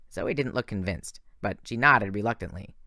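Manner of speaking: ordinary delivery, nothing marked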